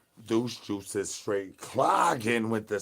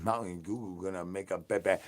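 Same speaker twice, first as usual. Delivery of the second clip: normal talking voice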